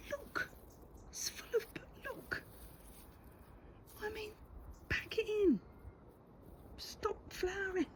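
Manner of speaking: high, teasing pretend telling-off; mock scolding. Gardener pretend-scolding wallflowers that won't stop blooming. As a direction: scold the plant in fun.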